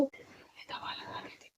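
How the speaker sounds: slow, breathy whisper. Real, not acted. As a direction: whisper so nobody wakes. Mother whispers near sleeping crow. (धीमी फुसफुसाहट)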